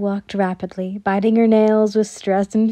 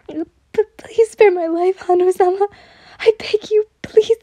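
very high, stammering plea; terrified pleading; 10 semitones higher, 4 dB louder than usual. A narrator's reading. Servant begging her mistress to spare her, terrified pleading. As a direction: beg desperately in a high girlish voice. Girl begs for her life.

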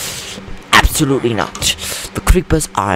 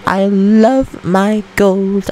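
slow drawn-out coo; 10 semitones higher, much slower than usual. Acted. Doting over gold, slower.

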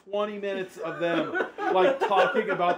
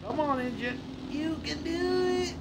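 much higher, coaxing the engine; pleading high urging; 10 semitones higher, 3 dB quieter than usual. Real, not acted. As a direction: beg the car to make it. Urging the car on.

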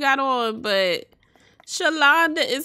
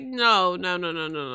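mock pissed; 5 semitones lower than usual. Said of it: "Blessing is pissed".

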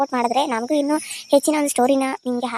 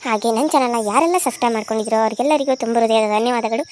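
ordinary talk, nothing marked

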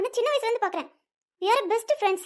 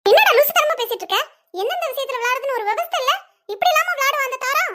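raised, higher-pitched telling-off; indignant scolding; 4 semitones higher, 4 dB louder than usual. Acted. Parent berating son over Tara's game, indignant scolding. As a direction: scold with loud disbelief at the start.

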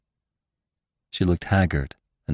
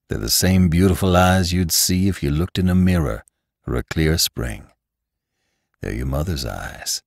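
tender Lassiter; 4 semitones lower than usual. Acted. Lassiter tells Bess who her mother was, tender and low. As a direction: reveal it to her gently, low.

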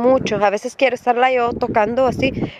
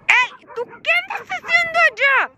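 high scolding call to a dog; 12 semitones higher than usual. Real, not acted. Regañando a la perrita de lejos. (llamada aguda a la perrita)

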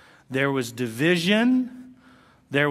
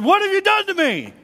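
much higher, louder, accusing cry; accusing wife; 7 dB louder than usual. Retold or imitated. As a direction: hurl the blame at him, voice up high. Sarah confronts Abraham angrily.

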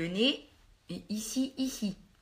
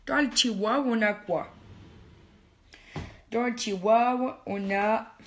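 playful put-on foreign accent, R in tiroir said like a W; slower than usual. Vlogger digging through her drawer, in a playful put-on foreign accent. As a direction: ham up an unnamed foreign accent while rummaging. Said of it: "je prends cet accent".

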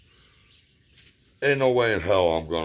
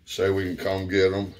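ordinary delivery

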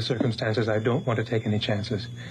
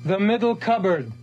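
calling out; 6 semitones higher than usual. Acted. Man repeating himself to an unseen woman, calling out. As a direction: raise the voice to carry across the house.

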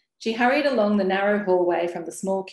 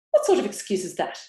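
scoffing; 3 semitones higher, faster than usual. A narrator's reading. Neighbour scoffs at excuse.

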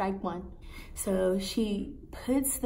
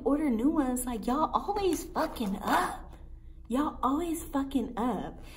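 high, whiny exasperated complaint; exasperated retort; 6 semitones higher than usual. Retold or imitated. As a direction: complain in high-pitched disbelief. Exasperated retort in retold quarrel.

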